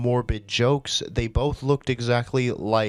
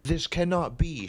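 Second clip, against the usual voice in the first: higher-pitched indignant reading, no real shout; mild angry shout; 6 semitones higher, 4 dB quieter than usual. A narrator's reading. Narrator voicing an angry woman's protest, mildly indignant. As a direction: read it as a light mock shout. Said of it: "she shouted angrily".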